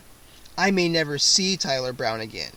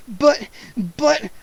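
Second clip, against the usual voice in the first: frightened stammer, higher; 7 semitones higher, 4 dB louder than usual. A narrator's reading. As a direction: stumble over the words in panic. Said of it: "I stammered".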